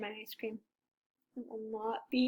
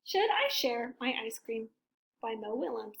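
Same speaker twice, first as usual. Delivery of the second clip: plain reading voice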